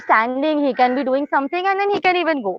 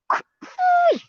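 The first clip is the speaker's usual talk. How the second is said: praise. Real, not acted. Praising dog in high voice.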